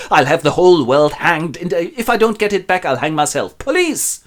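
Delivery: frantic raving, ending on a high cry; raising hell. Acted. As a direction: rant in panic, then squeal for help. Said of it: "raises hell and screams blue murder".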